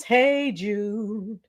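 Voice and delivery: usual speaking voice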